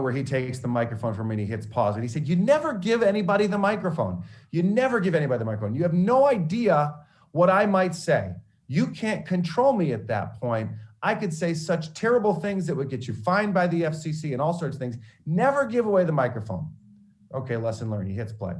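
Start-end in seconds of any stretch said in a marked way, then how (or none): 2.3-4.2 high, loud, emphatic scold with NEVER hit hard; stern scolding. Retold or imitated. Professor scolding the student about the microphone, loud and stern. 4.5-5.7 same scold again, fast and a bit higher; rapid repeat. Retold or imitated. Professor hammering the rule home, fast and insistent. 5.8-16.7 stern professor. Retold or imitated. Professor warning the student never to hand over the microphone, stern.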